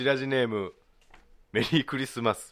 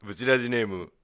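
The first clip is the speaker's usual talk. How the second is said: usual speaking voice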